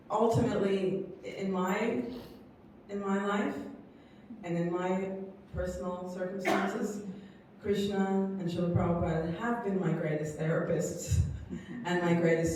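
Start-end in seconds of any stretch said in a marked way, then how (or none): none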